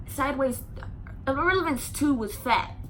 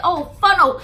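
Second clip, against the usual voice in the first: louder outraged mother; 5 semitones higher, 9 dB louder than usual. Retold or imitated. Aisha's mother hearing of the fight, a loud outraged no. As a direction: say it hot and loud.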